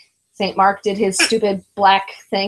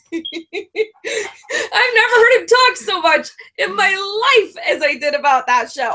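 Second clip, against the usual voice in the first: normal talking voice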